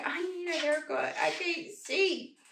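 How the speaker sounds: playful small-animal complaint; voicing the pet. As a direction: speak up for a shaggy little dog. Speaks for the shaggy pet.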